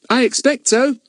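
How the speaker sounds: put-on bright, high cheerful boy. A narrator's reading. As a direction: answer breezily as a boy. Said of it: "said Pip cheerfully".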